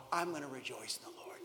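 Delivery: soft whispered confession; a whisper of quiet trust. Pretend. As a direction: breathe it out as a private vow. Habakkuk's quiet vow.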